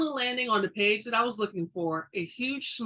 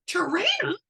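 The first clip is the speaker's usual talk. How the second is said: high, rising reproach on Tarana; sassy; 10 semitones higher, 6 dB louder than usual. A narrator's reading. Sandra scolding Tarana, sassy. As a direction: sass her name back at her. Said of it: "Sandra sassed at me".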